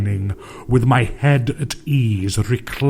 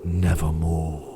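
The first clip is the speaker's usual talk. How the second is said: raven's reply; 5 semitones lower, 5 dB quieter than usual. A narrator's reading. The raven answers the grieving narrator, low and croaking. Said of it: "Quoth the raven".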